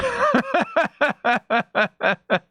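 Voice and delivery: high, rapid staccato cackle; long machine-gun character laugh; high put-on cackle, pulsing evenly; Benjamin Pickles. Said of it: "My Benjamin Pickles impression".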